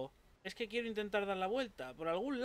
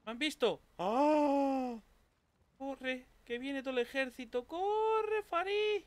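high panicked yells while fleeing; being chased; 8 semitones higher, 6 dB louder, slower than usual. Real, not acted. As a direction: shout to run, scared and frantic. Enemy army chasing him.

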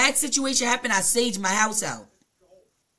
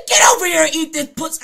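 louder, higher, bossy command; loud demand; 8 semitones higher, 10 dB louder, faster than usual. Retold or imitated. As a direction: order him over loudly. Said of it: "She said".

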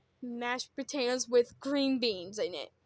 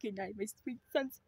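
much softer tender goodnight; 6 semitones lower, 11 dB quieter than usual. Acted. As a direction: say goodnight gently. Dad tucking in sons.

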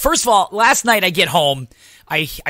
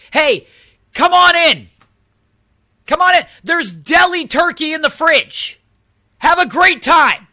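higher, louder hammed-up cheery invitation; mock welcome; 9 semitones higher, 5 dB louder than usual. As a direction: sarcastically welcome burglars in. Mock sign inviting crack addicts.

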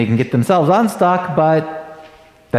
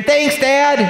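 put-on much higher, bright-eyed little boy thanking his dad; grinning kid; 10 semitones higher than usual. Little son thanking dad for the Frisbee, high happy kid voice. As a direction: play the grateful son, pitch way up.

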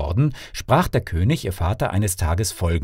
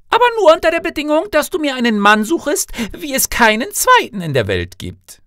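high-pitched, earnest princess voice; princess daughter; 4 dB louder than usual. Acted. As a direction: agree on one firm condition, pitched high. Daughter sets her condition.

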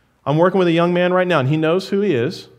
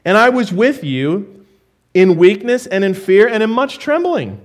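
scripture reading; 2 semitones higher than usual. Acted. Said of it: "Look at verse three".

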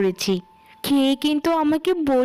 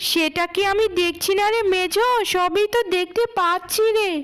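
put-on higher-pitched, indignant young woman talking back; angry elder sister; 7 semitones higher than usual. Eldest daughter protesting her mother's scolding, higher and indignant.

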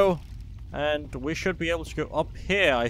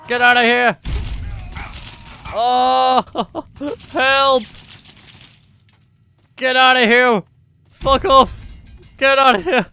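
panicked yelling; 7 semitones higher, 10 dB louder than usual. Real, not acted. Swarmed by enemies in game.

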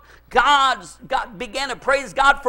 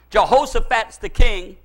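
ordinary delivery

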